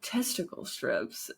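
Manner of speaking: whispered. Real, not acted. Mock-serious aside.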